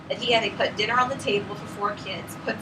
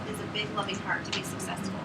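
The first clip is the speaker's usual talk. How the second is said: soft whisper; 2 semitones lower, 7 dB quieter than usual. Real, not acted. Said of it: "closing heartfelt line".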